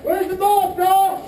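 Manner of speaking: calling out. Real, not acted. Calling out during the game.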